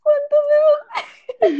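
very high, slow, laughing; exaggerated fussing teacher, squeaky and slow; mock teacher. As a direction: stretch "¿Cuántos dedos?" up high through a laugh.

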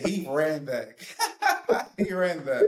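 laughing high through the words. Real, not acted. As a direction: laugh it out in delight. Laughing at the clip.

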